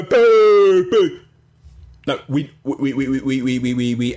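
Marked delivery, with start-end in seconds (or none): 0.0-1.2 louder playful boo; jokey pantomime boo; mock booing. Booing GeForce mention.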